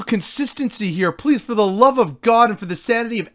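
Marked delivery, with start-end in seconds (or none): none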